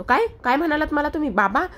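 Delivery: shocked. Acted. Parth shocked at being called baba.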